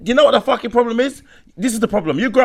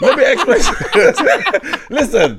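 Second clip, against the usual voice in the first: laughing; 3 semitones higher, slower than usual. Real, not acted. Laughing while trying to explain.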